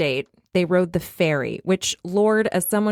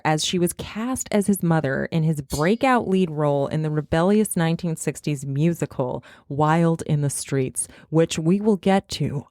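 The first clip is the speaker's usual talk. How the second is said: ordinary talk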